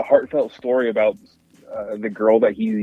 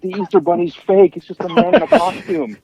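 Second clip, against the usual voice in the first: put-on higher, louder scornful jeer at the kids; mock taunt; 9 semitones higher, 3 dB louder than usual. Retelling the guy mocking kids about the Easter Bunny, scornful jeer. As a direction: sneer it like a grumpy bully.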